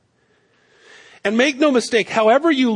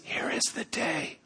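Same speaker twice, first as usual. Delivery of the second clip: unvoiced hushed whisper, much softer; 4 semitones lower, 13 dB quieter than usual. Shown as a demonstration. Men hiding in a cave, whispering to David. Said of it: "They'd have been whispering".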